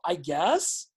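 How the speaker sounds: "I guess" rising like a question; mock up talk. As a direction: tack on a doubtful rising "I guess". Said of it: "every pause you go".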